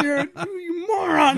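high, drawn-out mock outrage; exasperated yell. Dad berating son over one paper towel, exasperated high yell. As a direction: wail the insult in comic despair.

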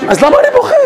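very high, exclaimed disbelief; preacher voices a listener's disbelief, high and incredulous. Pretend. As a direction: throw the question up in mock disbelief. Rhetorical self-question in sermon.

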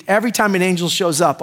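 ordinary talk

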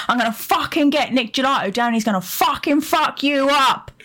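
tough northern voice rising up high; a lightly done rough Geordie threat; mock northern thug. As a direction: play a hard lad making threats. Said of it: "Or do we go".

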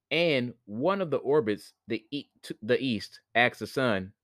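ordinary delivery, nothing marked

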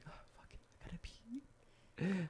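slow, resigned whisper. Retold or imitated. Patient whispering to himself as he gives up. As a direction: give up and breathe it out slowly. Giving up, peeing in hospital.

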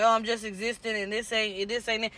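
low, soft, glum muttered quote; glum self-quote. Retold or imitated. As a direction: sound drained and flat-out done with it. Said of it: "when I be feeling like".

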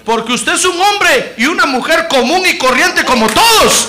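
shouting sermon climax; preaching at full force. Acted. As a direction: drive it home to the congregation.

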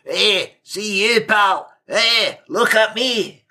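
high-pitched mock Scottish heckler; loud Scot. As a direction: imitate a small, attention-seeking Scot calling out. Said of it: "Scottish are loud".

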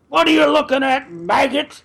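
high, loud barking drill sergeant. Retold or imitated. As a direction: bark it like a wrestler sergeant. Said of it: "we can get [name]".

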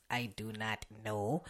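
under his breath, unvoiced; whispered aside. Real, not acted. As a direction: drop to a whisper as if to himself. Muttered aside to himself.

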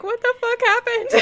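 squealing, high laugh through words. Pretend. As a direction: crack up while saying it. Laughing through a joking quote.

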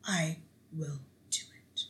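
soft, low mock compliance; meek agreement. Gaetz giving in to Trump's call, softly and low. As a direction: murmur it like a beaten man. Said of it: "Matt was like".

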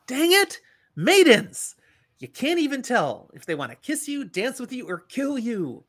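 high-pitched, loud exclamation easing in volume; acted character's frustrated complaint; exasperated outburst. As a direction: cry out in mock exasperation, then rant on high.

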